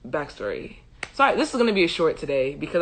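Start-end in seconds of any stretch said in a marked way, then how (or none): 0.1-0.8 BACKSTORY dropped low and soft, falling; quiet low repeat. Real, not acted. Storyteller repeats the heading to herself, BACKSTORY low and soft. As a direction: say BACKSTORY softly under the voice.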